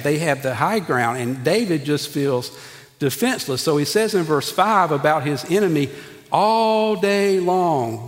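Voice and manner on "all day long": "All day long" slowly stretched, each word held; drawn-out ALL DAY LONG. Acted. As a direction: drag out "All day long" to show endlessness. Reads verse with stretched emphasis.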